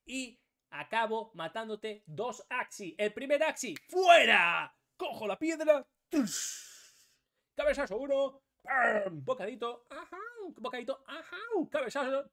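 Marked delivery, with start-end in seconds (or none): 3.0-9.2 louder and higher, calling each hit; loud excited commentary; hyped play-by-play. Real, not acted. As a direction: call the blows like a fight commentator.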